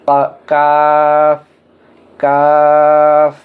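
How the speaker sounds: কাফ with a long, level vowel, very slow; drawn-out letter name. Shown as a demonstration. Teacher naming the Arabic letter for learners, কাফ drawn out long. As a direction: stretch the middle of কাফ on an even tone. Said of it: "এই হরফটির নাম হলো".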